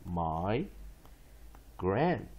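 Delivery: "my", pause, then "grant"; syllable by syllable. Shown as a demonstration. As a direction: split it into "my" and "grant" with a clear gap. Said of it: "one more time".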